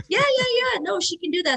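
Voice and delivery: high-pitched eager yeah-yeah; acted-out teammate's high assurance; hasty reassurance. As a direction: reassure brightly, voice jumping up.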